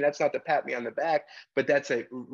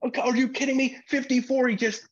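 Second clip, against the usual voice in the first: much higher, exasperated protest; mock coach outburst; 13 semitones higher, 3 dB louder, faster than usual. Coach complaining to the referee about his player, mock yell. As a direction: sell the coach's disbelief. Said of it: "So coach is yelling".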